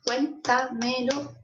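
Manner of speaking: 'Cuéntamelo' slowly sounded out as cuén-ta-me-lo; testing the stress. Shown as a demonstration. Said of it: "¿Y dónde cargo la voz?". ('Cuéntamelo' lento, buscando la sílaba tónica)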